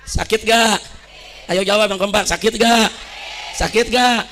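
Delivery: ordinary talk, nothing marked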